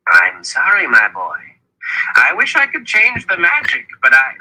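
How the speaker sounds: high-pitched, regretful elder; Zed's apology. A narrator's reading. Zed apologising to his boy Richard, regretful. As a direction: apologise in a high old-mentor voice.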